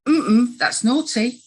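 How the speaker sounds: tut-tut like a disapproving parent; critical parent. Acted. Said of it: "It's your critical parent voice there".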